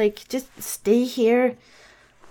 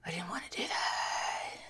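unvoiced, much softer whisper; 15 dB quieter than usual. Real, not acted. Bedtime storyteller muttering to the child, whispered. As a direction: breathe it as an aside. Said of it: "whispered aside to child".

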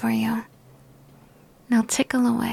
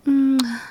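kiss sound; 4 dB louder than usual. Acted. Kissing her partner again.

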